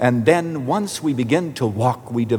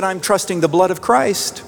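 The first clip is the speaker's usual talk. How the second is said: higher-pitched imitation of the caller; lightly voiced earnest woman; 6 semitones higher than usual. Woman answers her pastor on the phone, lightly imitated earnestness. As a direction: pitch it up as an earnest old lady.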